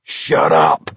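low forceful yell; gruff shouted order. A narrator's reading. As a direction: bark it to silence him. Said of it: "Abraham yelled".

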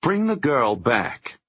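high, slow, loud order; cold command. Acted. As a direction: demand it with menace. Liz orders thug, continuing.

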